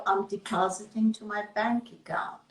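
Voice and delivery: breathy whispered aside. Shown as a demonstration. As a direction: let it drop to a secret whisper. Money metaphor, whispered aside.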